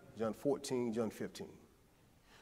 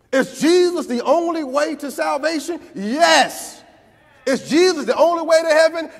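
louder, higher preaching that keeps building; full-force preaching; 12 semitones higher, 17 dB louder than usual. Acted. Preacher driving the point home to the congregation, full-force preaching. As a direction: whoop it up like the peak of a sermon.

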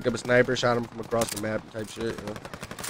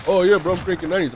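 mocking nephew; 7 semitones higher, 7 dB louder, faster than usual. Imitating boastful nephew.

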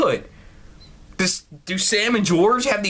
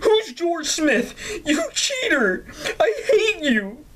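high-pitched mock wailing fury; hammed-up jealous outburst; 11 semitones higher, slower than usual. Player voices Sam finding out about George, hammed-up outrage. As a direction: wail the accusations in mock heartbreak.

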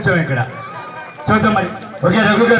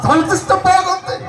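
imitating Prakash Raj, higher and punchy; Prakash Raj impression; 7 semitones higher than usual. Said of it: "Prakash Raj గారు ఇదే వేదిక మీద మాట్లాడితే ఎలా ఉంటుంది". (ప్రకాష్ రాజ్ గొంతు అనుకరణ)

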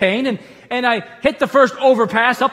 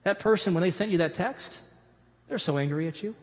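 a hint of a softer, whispering worry; hushed inner voice; 2 semitones lower, 8 dB quieter than usual. The preacher voices his mind planting a doubt, hushed. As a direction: murmur the doubt in his ear. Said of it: "your mind's gonna come in and say".

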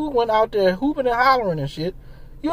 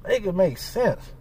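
low, slow, soft flat statement; tired final make it make sense; resigned; 3 semitones lower, 7 dB quieter, slower than usual. Real, not acted. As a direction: drop it low and slow, done with it. Resigned repeat after long pause.

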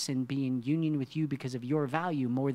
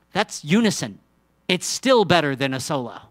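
loud, high, rising sermon voice; preaching at full force; 3 semitones higher, 11 dB louder than usual. Acted. Preacher rousing the church about harmony, full force. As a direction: preach it out to the whole congregation.

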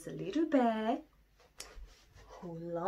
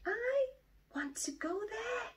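high, pleading little bear voice; Little Bear asking; 14 semitones higher than usual. Acted. Little Bear begs his daddy to take him, pleading high. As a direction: beg like a small cub.

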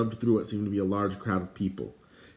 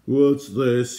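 loud, raised-pitch demand; outraged loud question; shouting; 4 semitones higher, 8 dB louder than usual. Acted. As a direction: shout as an irate king. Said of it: "he shouted".

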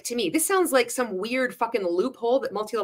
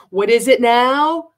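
loud, slow, drawn-out mock of a salesman baiting the crowd; exaggerated egotistical sales-pitch prompt; mocking presenter voice; 4 semitones higher, 10 dB louder, slower than usual. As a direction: ham up a smug presenter making the audience fill in the blank. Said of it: "who fucking talks like that".

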